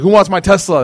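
loud, high hawker's call; mock sales shout. Teacher playing a joking car seller, loud high shout. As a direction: shout it out like you're auctioning the Tesla.

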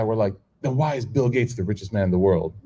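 normal talking voice

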